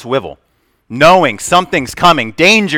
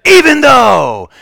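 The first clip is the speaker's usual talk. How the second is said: high, slow shouted "Even though!"; yell; 11 semitones higher, 5 dB louder, slower than usual. Retold or imitated. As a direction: blast "Even though!" to startle the kids. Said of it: "but I yelled".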